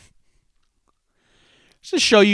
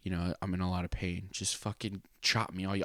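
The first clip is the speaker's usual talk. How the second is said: hushed plea; 9 dB quieter than usual. Retold or imitated. Quietly asking wrestlers for mercy.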